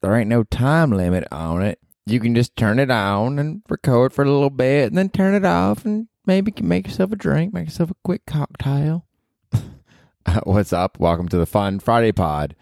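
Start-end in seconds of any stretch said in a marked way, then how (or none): 0.0-9.1 put-on Southern accent. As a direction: play a Southern girlfriend, voice rising as it goes. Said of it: "making up some Southern voice".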